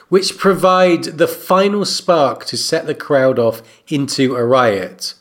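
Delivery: ordinary talk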